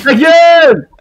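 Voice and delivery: playful loud shout; mock shout. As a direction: bark back playfully. Banter fight.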